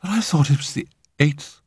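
halting, high-pitched confusion; falter, then protest in puzzlement; bewildered. A narrator's reading. Arthur learning he has lost weeks, bewildered. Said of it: "His bewilderment was evident".

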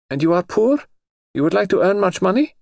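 lightly voiced high noble lady; a touch of a high, haughty aristocrat; lady's voice. Princess questions Jane.

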